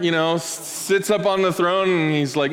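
usual speaking voice

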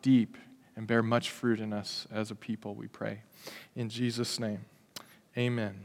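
soft, hushed pastoral prayer. Real, not acted. Pastor praying softly for the church before the sermon. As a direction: pray it quietly over the congregation.

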